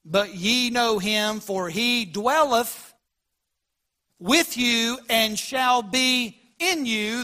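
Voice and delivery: very slow, weighing each word of the verse; slow emphatic rereading. Shown as a demonstration. Pastor rereads the verse so the congregation notices, very slowly. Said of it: "notice the last part of that verse".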